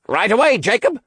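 high, eager call back; high-pitched shout of assent. A narrator's reading. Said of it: "Oliver called out".

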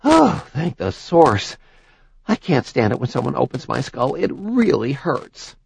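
relieved. Acted. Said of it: "relieved breath".

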